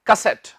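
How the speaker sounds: CASSETTE as kuh-SET; correct English stress. Shown as a demonstration. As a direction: land on the second syllable of CASSETTE. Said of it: "कैसेट नहीं".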